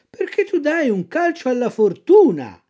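sly fox. Acted. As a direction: press him like a tempting fox. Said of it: "la volpe".

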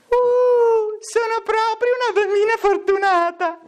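put-on very high falsetto girl; high, mock-blissful wail. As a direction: swoop into a hooting falsetto.

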